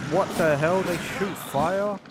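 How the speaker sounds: loud, high what the hell; shocked disbelief. Real, not acted. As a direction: blurt it out in disbelief, then stretch the question slowly. Enemy suddenly shoots fire.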